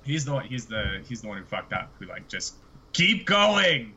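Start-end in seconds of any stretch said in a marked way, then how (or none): 2.9-3.9 barked mock command, higher and louder; hammed-up shout to press on; mock order. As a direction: shout it like a reckless boss. Mimicking the boss pushing on.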